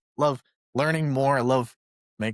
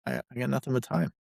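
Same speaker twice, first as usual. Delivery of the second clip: ordinary talk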